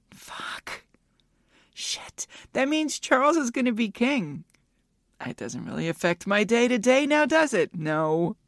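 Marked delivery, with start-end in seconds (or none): 0.1-2.2 whispered swear. Real, not acted. Whispered swear about the Queen.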